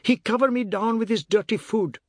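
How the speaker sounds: high-pitched wailing tattle; bawling complaint. A narrator's reading. Boy calling Ma about son-in-law, bawling complaint. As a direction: bawl for mother in outrage. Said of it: "the bawling continued".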